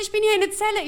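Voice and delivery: put-on very high old-lady voice; high-pitched, half-shouted mock distress; shouting grandma. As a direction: wail it down the phone as granny. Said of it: "schreit sie".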